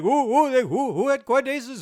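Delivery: loud, high-pitched mock Indian accent. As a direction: imitate an excited Indian man, high and loud. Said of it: "imitating his accent".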